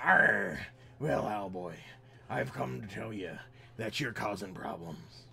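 slow, lightly growled space pirate; a hint of a pirate captain. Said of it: "he was a pirate, a space pirate".

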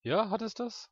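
high, arch sceptical question; haughty disbelief. Acted. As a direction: look down on her.

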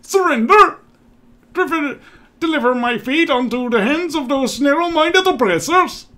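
high, declamatory character voice, loud on the outbursts; defiant leader. Acted. Cornered leader refuses to surrender to oppressors, defiant. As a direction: proclaim the cause in a high put-on voice. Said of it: "I think I'll give him a better voice".

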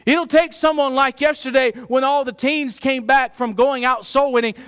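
normal talking voice

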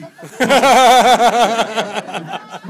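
very loud high laugh; roaring laugh. Real, not acted. Telling the chicken chase story, roaring laughter. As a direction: burst out roaring.

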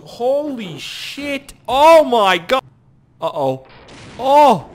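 yelling out at the sudden chaos; a loud startled cry; shocked shout. Real, not acted. Gamer startled by wild dogs, yelling in shock.

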